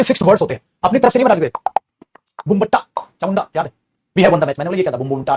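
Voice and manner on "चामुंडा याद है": ordinary talk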